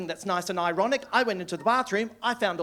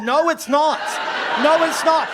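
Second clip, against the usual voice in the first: high and loud, insisting; loud insistence; 8 semitones higher, 9 dB louder than usual. Retold or imitated. Comic insisting to the parents the joke is great, loud. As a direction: shout them down. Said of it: "I said".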